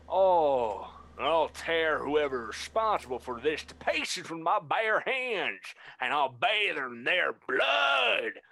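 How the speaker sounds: high, loud vow, slowing at the end; furious yell. Acted. Deer noble yelling threats of revenge. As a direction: savage threat, drawn out. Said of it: "just yelling out".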